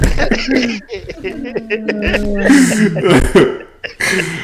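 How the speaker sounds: long, high-pitched laugh; laughter. Real, not acted. Cracking up after their own shopping story, long high laughter.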